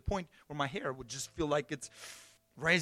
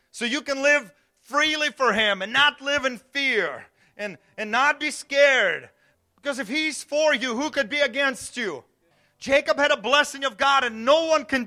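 lifted, louder sermon voice; preaching; 7 semitones higher, 7 dB louder than usual. Acted. Pastor urging the church not to live in fear, preaching louder. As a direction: drive the message home to the church.

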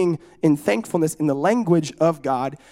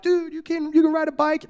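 put-on much higher, amazed kid voice; impressed kid cousins; 9 semitones higher than usual. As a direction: gush like a kid who can't believe it. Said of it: "My cousins were like".

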